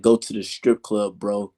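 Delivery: tossed off with a smug grin; smirking. Retold or imitated. Fury brushing off a fan, smirking.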